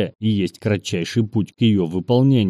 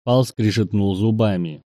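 usual speaking voice

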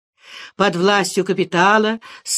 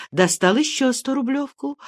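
ordinary delivery, nothing marked

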